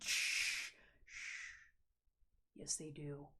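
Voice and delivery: whispered shush, then a soft low "yes, they do". Real, not acted. Hushing a viewer who says they're too weird, whispered shush. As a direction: hush them gently, then reassure softly.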